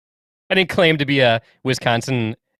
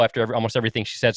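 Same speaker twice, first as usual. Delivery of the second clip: ordinary delivery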